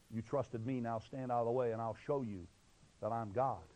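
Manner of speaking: low, soft voice of God; quiet assurance. Acted. God reinforcing a believer who took the step, low and assured. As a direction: speak low with calm authority.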